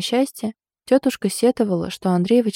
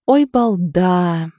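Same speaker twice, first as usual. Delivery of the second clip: very slow, louder exclamation; drawn out; 5 dB louder, much slower than usual. A narrator's reading. Aunt reacts to her clowning nephew, drawled exclamation. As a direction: stretch the scolding word right out. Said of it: "протянула тётя Надя".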